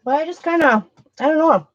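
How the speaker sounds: chewing while talking; mouth full. Real, not acted. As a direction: talk through a mouthful. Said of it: "excuse me with chewing with my mouth full".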